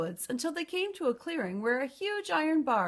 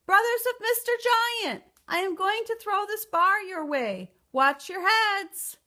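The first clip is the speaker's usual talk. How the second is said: loudest call; 10 semitones higher, 5 dB louder than usual. A narrator's reading. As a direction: shout it out toward far-off Canada. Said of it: "cried out in her loudest voice".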